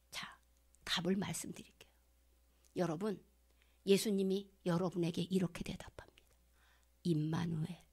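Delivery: hushed reveal, near whisper. Real, not acted. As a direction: lean in and whisper the answer. Revealing answer in hush. (속삭이듯 비밀을 알려주며)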